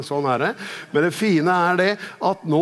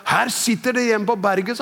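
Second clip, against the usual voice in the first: higher-pitched, mild mock shout; retold shout; 6 semitones higher than usual. Preacher quoting what the Spirit shouted, moderately raised cross voice. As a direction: call it out as a quoted rebuke. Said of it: "Helligånd ropte til meg".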